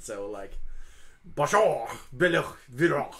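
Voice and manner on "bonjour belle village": loud guttural dragon tongue with a French accent; Draconic with French accent. Shown as a demonstration. Hugo speaks Draconic to the dragon, French accent. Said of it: "Draconic with a French accent".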